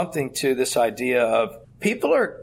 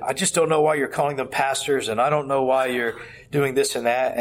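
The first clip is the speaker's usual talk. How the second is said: ordinary talk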